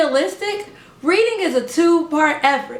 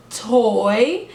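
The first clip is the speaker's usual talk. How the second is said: "Toy" stretched and mouthed clearly; name the toy slowly for the child; slow over-enunciated; 4 semitones lower than usual. Shown as a demonstration. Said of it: "let's practice".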